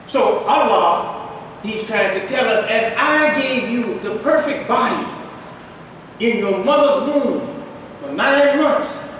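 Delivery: ordinary talk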